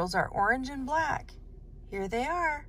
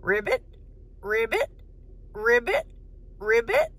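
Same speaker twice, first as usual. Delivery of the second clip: playful ribbits, each rising; a light frog imitation; frog sound. Shown as a demonstration. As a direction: croak like a frog for the kids. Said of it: "Can you make a sound like a frog?".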